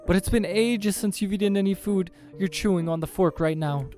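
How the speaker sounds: high-pitched, loud objection; whiny-high man defending himself; protesting date. Acted.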